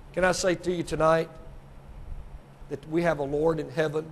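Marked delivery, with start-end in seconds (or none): none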